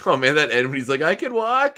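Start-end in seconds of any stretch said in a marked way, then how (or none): 1.0-1.8 high-pitched triumphant quote; imitated movie exclamation. Fan quoting Strangelove's ending, high excited cry.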